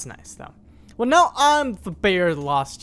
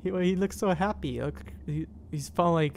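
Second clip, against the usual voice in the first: normal talking voice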